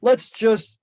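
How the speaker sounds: usual speaking voice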